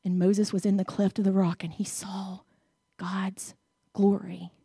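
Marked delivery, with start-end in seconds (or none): 1.6-4.5 whisper. Acted.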